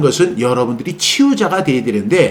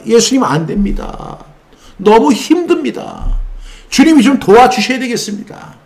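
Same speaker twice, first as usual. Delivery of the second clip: pleading prayer; 7 semitones higher than usual. Retold or imitated. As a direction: beg the Lord for help, weary. Believer praying for help.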